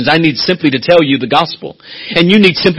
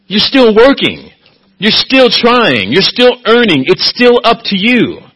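higher, slow, hammering each still; emphatic preaching; 9 semitones higher, 3 dB louder, slower than usual. Acted. Preacher rebutting works salvation, slow and emphatic. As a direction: pound the word still home.

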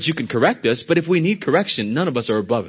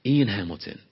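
usual speaking voice

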